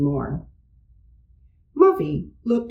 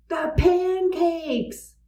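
1 alarmed cry; 10 semitones higher, slower than usual. A narrator's reading.

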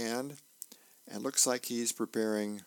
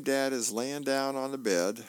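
ordinary talk

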